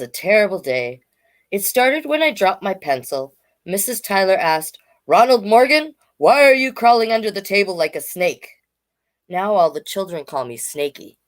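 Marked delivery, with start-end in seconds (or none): 5.1-8.5 higher, louder teacher voice; a class teacher telling off Ronald; scolding teacher. Acted. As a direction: scold him like a cross teacher.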